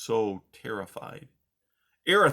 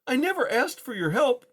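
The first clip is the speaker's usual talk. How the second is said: exasperated; 13 semitones higher, 4 dB louder than usual. Acted. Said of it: "an exasperated Poppy replied".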